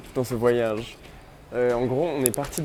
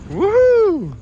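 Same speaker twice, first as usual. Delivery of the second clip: high, loud joyful whoop; cheering whoop; 7 dB louder than usual. Real, not acted. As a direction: whoop it out, full of joy. Cheering as they leave.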